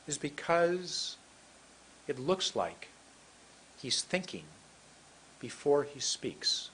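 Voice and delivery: slow, deliberate phrases with long pauses; thinking before speaking. Acted. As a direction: pause as if weighing each phrase. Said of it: "pretend like you're thinking".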